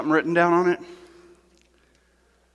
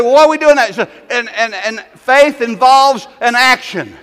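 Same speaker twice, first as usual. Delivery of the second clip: loud, high rallying calls; exhorting congregation; 5 semitones higher, 9 dB louder than usual. Acted. Pastor urging people to come throw their cares, shouting. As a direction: fire the crowd up, shouting.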